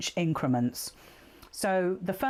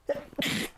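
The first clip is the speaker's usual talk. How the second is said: stifled sneeze cough; 7 semitones higher than usual. Real, not acted. Sneeze coming on.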